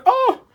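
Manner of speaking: very high squeaky "oh"; playful falsetto yelp after a voice crack. Pretend. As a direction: let out a sudden high cracked "oh". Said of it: "Excuse my voice crack".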